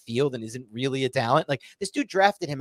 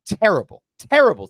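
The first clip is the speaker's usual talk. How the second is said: louder and higher on each terrible; angry repeated terrible, climbing; ranting emphasis; 9 semitones higher, 9 dB louder than usual. Real, not acted. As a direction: hammer terrible, building in anger. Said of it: "That's how you know the rant is on".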